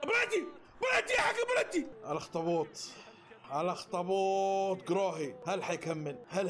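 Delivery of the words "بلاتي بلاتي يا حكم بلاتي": demanding a penalty. Real, not acted. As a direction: call for the penalty.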